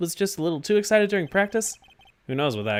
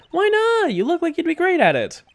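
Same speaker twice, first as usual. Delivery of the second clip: much higher and louder, pleading; excited girl voice; 13 semitones higher, 4 dB louder than usual. Acted. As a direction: squeal it like a giddy kid. Maya insisting, high voice.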